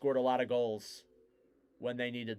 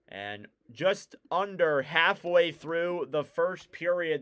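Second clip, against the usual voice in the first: brisk hockey commentary, rising on chances; live play-by-play; 4 dB louder than usual. Real, not acted. Commentator calls the Islanders game for listeners, live play-by-play. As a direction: follow the puck, lifting the call as danger builds.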